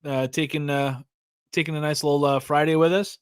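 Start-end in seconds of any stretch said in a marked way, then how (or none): none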